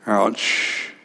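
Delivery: ordinary delivery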